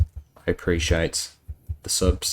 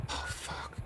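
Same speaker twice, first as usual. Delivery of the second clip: breathless whispered swear; whispered curse; 18 dB quieter than usual. Real, not acted. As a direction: whisper it under your breath.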